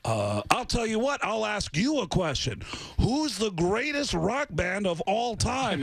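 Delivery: high, pompous quizmaster challenge. Retold or imitated. Fake rock star quizzes assistant, pompous. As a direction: boastfully set your own test. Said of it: "this is [name]".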